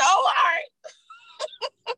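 slow, high, crying wail; wailing mock cry. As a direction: wail it out like fake sobbing. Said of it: "He said".

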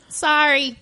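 Boston "Sorry", open broad vowel; Boston accent. Pretend. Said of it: "say you're sorry like you're from Boston".

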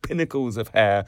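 usual speaking voice

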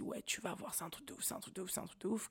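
soft, unvoiced retelling of friends' hype; breathy hush as if sharing a secret; mock hushed gossip. Quote of friends hyping labour.

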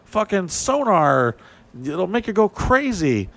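high, taunting mock threat; lightly voiced gloating sonar crew; mock menacing. Mock sonar crew taunting their target, high and gloating.

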